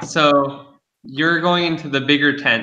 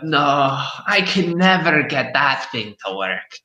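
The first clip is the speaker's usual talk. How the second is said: fortune teller; 7 semitones higher than usual. Acted. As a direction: boast like a gossipy hag. GM keeps crone voice.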